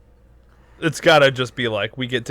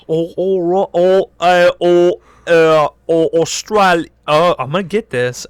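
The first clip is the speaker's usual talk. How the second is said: halting, mock Australian sounds, voice climbing; mock Australian practice; 5 semitones higher, 6 dB louder than usual. Imagined girl rehearsing an Australian accent at home, hammed up.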